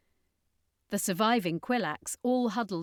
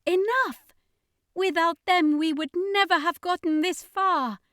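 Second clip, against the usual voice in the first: much higher, louder scream; 11 semitones higher, 7 dB louder than usual. Acted. Askiro screaming at the angry crowd, loud scream. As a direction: shout the crowd down. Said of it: "she screamed at the angry crowd".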